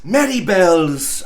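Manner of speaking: ordinary delivery, nothing marked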